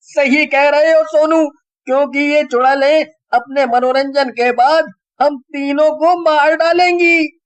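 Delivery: put-on high, loud anxious boys; worried friends. A narrator's reading. The three friends worrying how to beat the witches. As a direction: fret about the witches.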